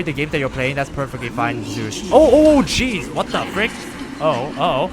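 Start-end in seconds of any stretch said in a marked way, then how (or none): 2.1-4.9 much higher, louder oh oh then jeez; yelped shock easing into disbelief; startled yelp. Real, not acted. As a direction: jump at a sudden scare. Startled by game event.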